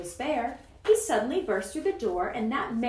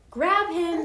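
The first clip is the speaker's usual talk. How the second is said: louder, higher gang command; shouted order; 5 semitones higher, 5 dB louder than usual. A narrator's reading. Wickersham gang seizing Horton, shouted order. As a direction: shout the order, a touch restrained. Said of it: "they shouted".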